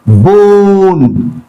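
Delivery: boon with the "oo" held long; long vowel. Shown as a demonstration. Teacher reading the word list, long vowel in boon. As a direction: stretch out the middle of boon. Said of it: "long vowel sound".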